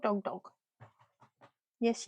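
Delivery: normal talking voice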